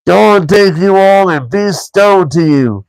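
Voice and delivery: slow, high troll curse; Gandalf's curse in a troll voice, drawn out. A narrator's reading. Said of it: "said a voice".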